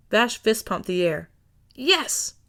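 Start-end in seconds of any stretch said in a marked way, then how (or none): 1.7-2.3 high triumphant cheer; gleeful yes. A narrator's reading. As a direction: celebrate the win. Vash fist pump.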